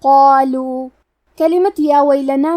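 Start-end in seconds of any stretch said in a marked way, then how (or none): 0.0-0.9 قالوا with a full, heavy ق and a long heavy alif; heavy qaaf. Shown as a demonstration. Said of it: "مد الف کو بھی بھاری آواز میں پڑھنا ہے". (موٹی آواز میں قالوا)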